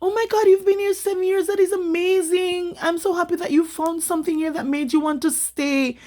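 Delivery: high, delighted, fawning welcome; gushing Japanese locals. Retold or imitated. Kind locals greeting the Jamaican newcomer, high and gushing. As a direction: gush over a foreigner like a thrilled host. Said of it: "they're like".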